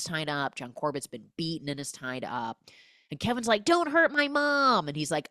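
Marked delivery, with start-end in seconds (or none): 3.6-4.9 much higher, slow plea; mock pleading. Kevin tied up, begging the killer, high slow plea. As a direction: beg him to spare her.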